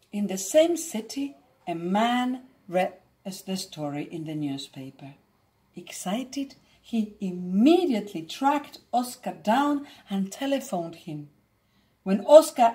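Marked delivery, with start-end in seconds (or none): none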